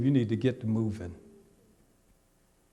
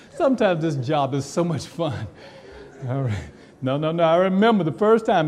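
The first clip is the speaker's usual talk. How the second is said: usual speaking voice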